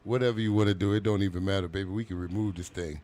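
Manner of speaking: smooth low promo read; low ad plug. Acted. Host plugs her coaching website in a low smooth voice.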